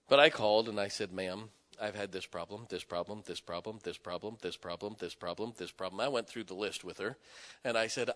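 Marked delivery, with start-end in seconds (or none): 1.1-6.0 low, quiet, restrained complaint; controlled firmness. Retold or imitated. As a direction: list it off evenly, holding the voice down. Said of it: "I didn't raise my voice. I didn't yell".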